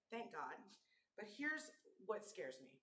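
ordinary talk

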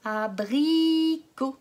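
Abricot stretched very slowly, each syllable separate. Shown as a demonstration. Teacher stretches Abricot so children hear I. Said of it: "Écoute bien, il est au milieu".

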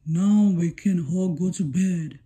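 low, lightly voiced dad; a hint of a deep father voice. A narrator's reading. Daddy Shark voiced.

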